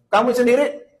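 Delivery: questioning angel. Acted. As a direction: demand answers like an interrogating angel.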